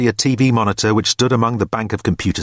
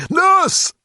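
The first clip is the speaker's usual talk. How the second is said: sudden high, loud bark of Nurse!; barked shout; 3 dB louder than usual. A narrator's reading. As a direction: bark for the nurse without warning. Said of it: "barked at unexpectedly like this".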